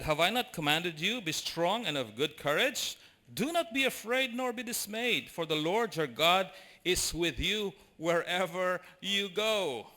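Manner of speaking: loud, slow, proclaiming God's words; booming scripture reading; preaching scripture. Acted. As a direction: declare it from the pulpit. Quoting scripture with force.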